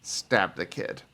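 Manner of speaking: usual speaking voice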